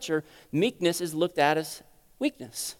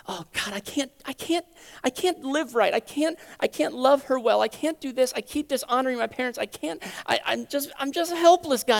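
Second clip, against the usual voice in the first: acted higher-pitched, stumbling desperate prayer; helpless plea; 5 semitones higher than usual. Believer confessing failures to God, helpless pleading. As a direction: plead helplessly, restarting as you go.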